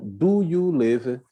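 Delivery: very slow, every word spelled out, falling at the end; slow model question. Shown as a demonstration. Teacher slowly repeating the question for the class. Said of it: "Então".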